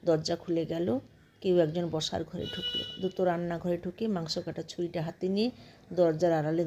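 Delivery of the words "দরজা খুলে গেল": ordinary delivery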